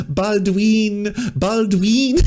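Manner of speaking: hammed-up mock accent repeating "Baldwin"; mock Spanish accent. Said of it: "I had to repeat it to my family three times".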